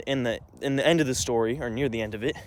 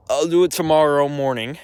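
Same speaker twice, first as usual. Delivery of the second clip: mocking voice for her promise; mimicking sister; 4 dB louder than usual. Mocking his sister's promise to take the dog. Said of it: "she eventually says".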